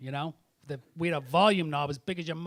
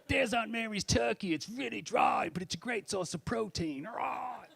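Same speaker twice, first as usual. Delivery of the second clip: Bear Grylls impression; 5 semitones higher than usual. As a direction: parody the host cheerfully. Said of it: "he's like".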